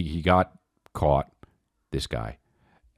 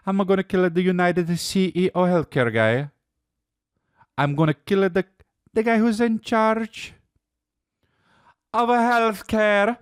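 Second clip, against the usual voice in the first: mocking Luigi voice. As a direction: squeak it like a silly cartoon villain. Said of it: "so Luigi Mangione".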